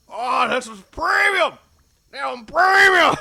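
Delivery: high, loud, slow anguished cry; hammed-up despair, stretched out; mock wail. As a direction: wail over the ruined goods. NPC grieving over ashes.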